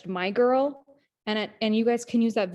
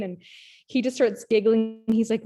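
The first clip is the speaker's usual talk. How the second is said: ordinary delivery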